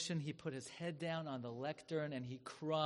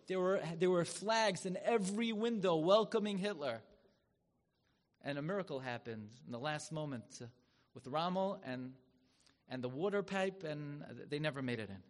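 usual speaking voice

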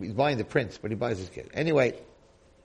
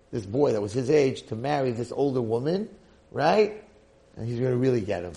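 ordinary delivery

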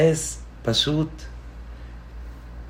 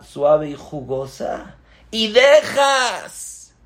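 raised stern instruction; commanding rabbi; 9 semitones higher, slower than usual. Acted. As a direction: stop them and point. Rabbi stops students again.

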